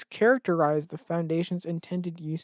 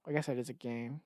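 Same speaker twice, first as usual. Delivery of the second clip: ordinary talk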